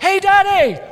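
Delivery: high-pitched mock little-boy call; excited young son. Son calling to dad before leaping at him, excited. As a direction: call out like a boy about to leap. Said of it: "He's like".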